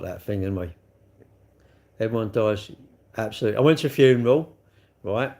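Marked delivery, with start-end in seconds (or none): none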